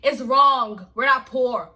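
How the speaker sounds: firmly stamp it out; emphatic repeated denial; insistent. Real, not acted.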